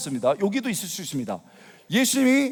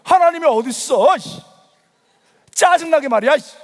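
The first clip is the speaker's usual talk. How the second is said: loud, raised, testy griping; retold irritated outburst; 6 semitones higher, 6 dB louder than usual. Unbeliever suddenly losing his temper at God, irritated. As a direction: act out a fit of temper. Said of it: "신경질이 나는 거예요".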